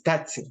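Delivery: "taxi" said as "tatsi"; Ecuadorian pronunciation. Shown as a demonstration. Repeating the Ecuadorian example, "taxi" with a ts sound. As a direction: turn the "x" of "taxi" into "ts". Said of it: "los ecuatorianos mencionamos taxi".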